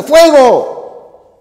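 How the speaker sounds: sudden fire alarm cry; alarmed shout. Shown as a demonstration. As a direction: yell "¡Fuego!" high and loud in alarm. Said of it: "está mostrando un susto".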